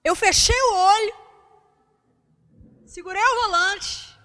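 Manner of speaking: ordinary talk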